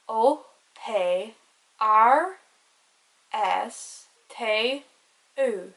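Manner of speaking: letters recited one by one with Irish names; Irish alphabet pronunciation. Shown as a demonstration. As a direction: say each Irish letter separately, clearly spaced. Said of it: "the pronunciation".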